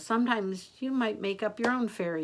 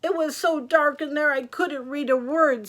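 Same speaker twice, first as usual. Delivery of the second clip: put-on high, louder old-lady voice; raised pitch, put-out; complaining Granny; 7 semitones higher, 6 dB louder than usual. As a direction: grumble lightly as storybook Granny. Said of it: "said Granny".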